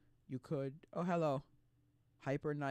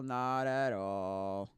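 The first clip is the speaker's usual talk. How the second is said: slow, sinking back down, drawn out; slow low drawn-out denial; 4 semitones lower, much slower than usual. Acted. Answering her own question, slow and settling low. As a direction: let it drop and drag at the bottom.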